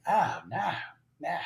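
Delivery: imitated Kiwi refusal; New Zealand accent. Sergeant major corrects his buddy, New Zealand accent. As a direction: say it with an Aussie-New Zealand twang. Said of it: "like, New Zealand, um, accent".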